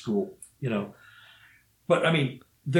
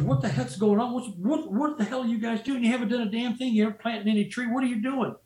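high, exasperated scolding; imitating the baffled boss chewing out his men; exasperated foreman; 8 semitones higher, faster than usual. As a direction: berate the crew for slacking. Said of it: "He runs out and goes".

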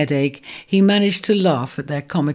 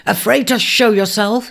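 higher, scornful challenge; scorning taunt, raised; 5 semitones higher, 4 dB louder than usual. Acted. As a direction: sneer it up at the hidden teasers. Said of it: "he scorned".

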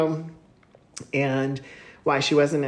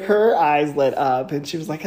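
normal talking voice

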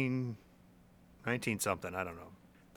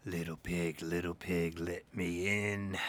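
big bad wolf; 7 semitones lower, 7 dB quieter than usual.

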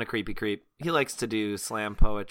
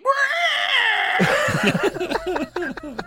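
hydra shriek; 5 dB louder than usual. Acted. GM voices hydra bursting out shrieking.

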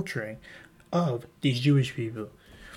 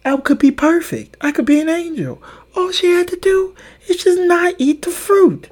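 much higher, louder whiny outrage; childish indignation; 9 dB louder than usual. Retold or imitated. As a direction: sulk loudly like a kid wronged. Childhood grudge against Eve.

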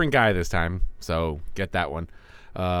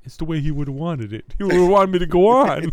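high put-on voice, rising louder; high, strained mock justification; mock grieving best friend; 8 semitones higher, 4 dB louder than usual. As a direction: ham it up as the bereaved pal. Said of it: "as your best friend said".

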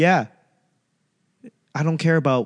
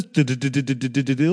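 flip book film noise; faster than usual. Retold or imitated. Mimicking a flip book of Babe Ruth with a projector buzz. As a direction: mouth noise of a flickering old film reel.

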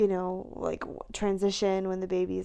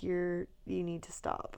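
stern inner voice; 2 semitones lower, 7 dB quieter, slower than usual. Retold or imitated. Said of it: "third person is like".

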